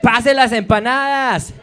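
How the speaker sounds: high, loud, slow Colombian Spanish mom call; proud Colombian mother shouting in Spanish. Retold or imitated. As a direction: belt it out like a Colombian mother at a party. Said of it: "That's my mom watching Narcos".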